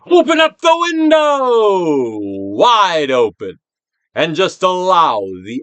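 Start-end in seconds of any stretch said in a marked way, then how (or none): 0.1-3.6 slow loud high call to fling it open; booming slow exhortation; loud command. Acted. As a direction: order the window wide open.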